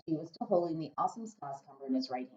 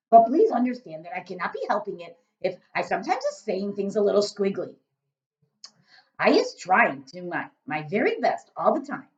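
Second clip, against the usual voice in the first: loud, booming friendly giant; a big-hearted giant rumbling away; 4 semitones higher, 9 dB louder than usual. Acted. BFG speaking.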